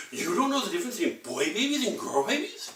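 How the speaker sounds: put-on high incredulous little girl; baby girl. Baby girl amazed at the boy, high voice. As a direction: scoff at him like a bossy toddler. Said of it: "The little baby girl's like".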